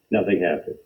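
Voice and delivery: ordinary delivery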